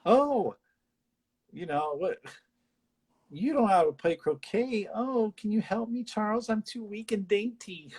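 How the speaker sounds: high, fake-sweet simpering voice; mock her as a weak, dainty damsel; sarcastic imitation of a rival asking for help; mocking catty rival. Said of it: "And it's like".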